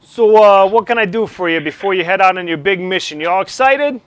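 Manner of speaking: loud, high New York officer; New York accent. Acted. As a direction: pump the pilots up, New York style. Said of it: "a New York accent".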